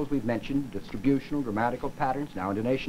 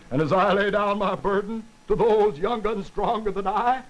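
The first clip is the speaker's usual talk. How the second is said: hammed-up politician, louder, voice breaking with feeling; emotional politician, breaking voice; 3 semitones higher, 4 dB louder than usual. Shown as a demonstration. Politician handing over his burden to the young, voice breaking emotionally. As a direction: break the voice as if deeply moved by your own oratory. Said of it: "that breaking is supposed to give you".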